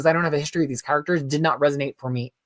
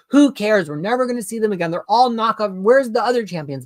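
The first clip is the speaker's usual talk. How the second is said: higher-pitched mock complaint; scoffing fan's gripe, pitched up; dismissive griping; 6 semitones higher than usual. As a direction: re-enact your old trash talk, raised and impatient. Mimics own earlier trash talk.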